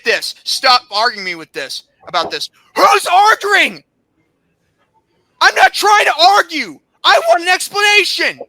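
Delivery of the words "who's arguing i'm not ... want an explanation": high, indignant outburst; heated, high-pitched objection; angry protest. Real, not acted. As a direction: throw it back angrily, voice raised. Angrily answers the admin's message.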